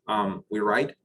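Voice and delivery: ordinary talk, nothing marked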